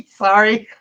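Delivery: giggling apology; amused giggle; laughing at own joke. Real, not acted. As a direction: laugh through the sorry.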